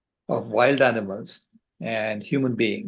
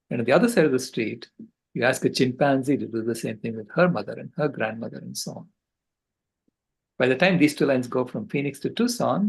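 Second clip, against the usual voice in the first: ordinary talk, nothing marked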